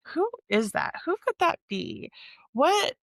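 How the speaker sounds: high-pitched mock bewilderment; acted shock. Reader acting out the reaction to a twist ending, acted shock. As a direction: imitate a baffled reader, pitch jumping up. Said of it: "like, completely shocked".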